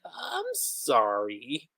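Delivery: a small, drawn-out apology, barely voiced; meek apology.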